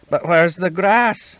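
high, slow, teary question; young voice on the edge of tears; snuffling. A narrator's reading. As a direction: ask it through sniffles like a homesick boy. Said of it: "Sean asked, snuffling".

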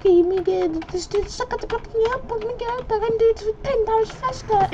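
high squeaky mock voice of a heckler; mocking imitation of critics. Mimicking viewers who criticise his blocking, mocking squeal. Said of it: "You're gonna be like".

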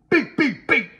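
high, loud playful beeping; mock beep sound. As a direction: beep it like a machine. Sound effect.

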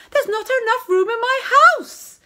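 high, loud complaining woman's voice; complaining young woman. Acted.

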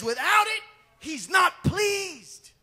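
high, loud and very slow; full-force pulpit shout; preaching shout. Real, not acted. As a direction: hammer the verse home. Preacher quoting scripture.

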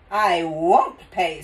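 slow, loud, with "won't" leaned on; firm refusal. A narrator's reading. Father refuses the cashier, stretching "won't" in firm refusal. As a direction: draw out "won't" firmly and loudly.

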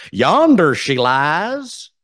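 Appalachian Romeo line, slow and hammed up; Appalachian accent. Shown as a demonstration. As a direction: savor each word in a thick Appalachian drawl. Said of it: "country Appalachian accent".

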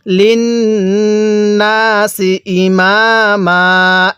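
drawn-out chanted recitation; slow Quran recitation. Shown as a demonstration. Teacher reciting slowly for students to repeat. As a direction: recite slowly, stretching each vowel. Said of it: "তাহলে কিভাবে পড়ব?".

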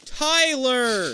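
high, loud scolding call; exasperated yell. Real, not acted. Player scolding Tyler mid-game, exasperated yell. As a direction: yell the teammate's name in protest.

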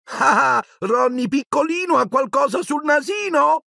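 put-on high baby-talk taunt; high, cooing sing-song mockery; teasing singsong. A narrator's reading. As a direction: chant it mockingly at a little brother. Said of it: "cantilenò uno dei gemelli".

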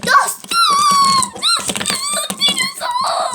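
very high, loud squealing calls; excited shouts. Pretend. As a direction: squeal with joy at a friend.

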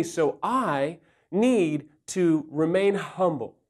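usual speaking voice

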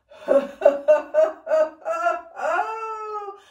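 high, loud ringing laughter; group ho laugh. Shown as a demonstration. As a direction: let a big laugh burst out. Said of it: "All together".

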